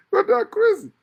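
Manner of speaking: very high, loud outburst; loud squeal of a remark; amused exclamation. Real, not acted. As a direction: burst out high-pitched through amusement. Laughing exclamation about crazy people.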